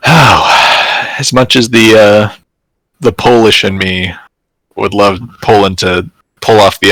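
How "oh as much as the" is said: normal talking voice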